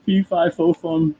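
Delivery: playful storybook giant woken from sleep; cranky creature. Acted. Host speaking for the just-woken creature, lightly cranky. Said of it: "He's cranky. He's like".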